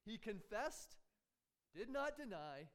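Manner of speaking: ordinary talk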